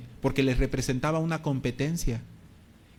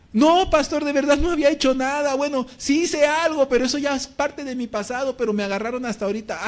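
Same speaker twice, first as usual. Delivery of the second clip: desperate excuse; 10 semitones higher, 5 dB louder than usual. Retold or imitated. Israel insists to the pastor he did nothing, high desperate pleading. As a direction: beg him to believe you. Israel defends himself, upset.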